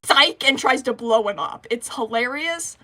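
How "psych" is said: gleeful trick. Retold or imitated. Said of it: "And then he goes".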